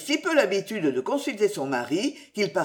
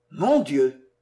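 stricken; 4 semitones lower, 4 dB louder than usual. Acted. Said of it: "Il pâlit et dit seulement".

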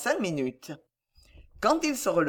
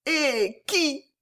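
high, faltering lady's question; stammered shock; 8 semitones higher than usual. A narrator's reading. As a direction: ask it aghast, in a high voice. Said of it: "balbutia la tante". (question aiguë, balbutiée)

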